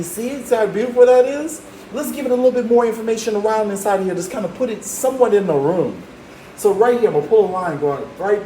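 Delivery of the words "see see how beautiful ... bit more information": excited. Real, not acted. Teacher proud of drawing.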